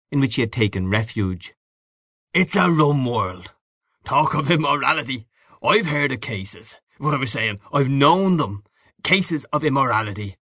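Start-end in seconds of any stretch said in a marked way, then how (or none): 2.3-10.4 higher-pitched, knowing man of the world; worldly Gallagher figure, pronouncing. Acted. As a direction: pronounce gravely, as one who has seen it all.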